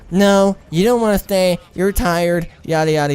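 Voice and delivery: high-pitched mock of her refusal; upset girlfriend. Imitates upset woman refusing.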